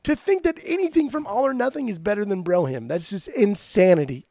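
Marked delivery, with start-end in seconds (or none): 0.0-1.9 mock exasperation. Real, not acted. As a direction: stretch it out high like you can't believe him. Mock insulting friend's taste.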